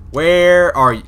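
high, loud call out; exasperated shout at the game; frustrated call. Real, not acted. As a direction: yell for it in frustration.

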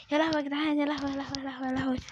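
usual speaking voice

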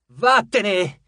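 loud commanding yell. Acted. Piper shouting at the turtle, loud command. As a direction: order the monster away. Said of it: "urlò alla tartaruga".

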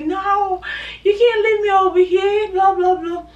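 high, loud pleading protest; sad pleading. Retold or imitated. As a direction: beg him not to leave, voice up high. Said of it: "I was so sad".

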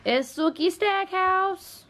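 high, slow drawn-out pet name; sing-song cat name. Pretend.

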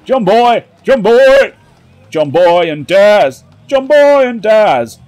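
loud high cheer for the teammate. Real, not acted. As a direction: shout the name in delight.

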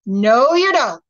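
king steps in loudly; firm king. A narrator's reading. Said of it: "said he".

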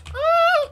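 sudden high startled scream. Real, not acted. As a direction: yelp as something jumps out. Jump scare in mining game.

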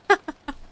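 quick burst of laughter; a short amused laugh. Real, not acted. Laughing at a joke. (petit rire)

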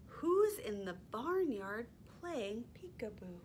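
lively, sing-song storytime reading; animated picture-book read-aloud; reading to toddlers. Acted. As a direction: read it like you're holding the book up for little ones.

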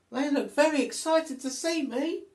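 little monkey thrilled to start climbing; excited little monkey. Acted. Monkey sees her troop.

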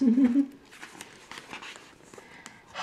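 quiet chuckle; little giggle; soft laugh. Real, not acted. Reader laughs.

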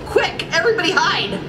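high-pitched urgent warning; mock-panicked storybook cry. A narrator's reading. Gladstone warns everyone about the ghost, crying out. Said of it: "cried Gladstone".

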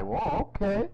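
high, amazed whoa okay; impressed whoa. Retold or imitated. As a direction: act stunned by the dancing. Host reacting as impressed onlooker.

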